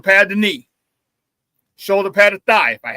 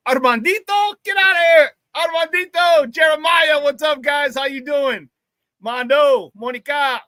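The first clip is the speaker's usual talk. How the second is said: excited shout-outs; 6 semitones higher than usual. Real, not acted. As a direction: shout out the fans by name. Shouting out chat names.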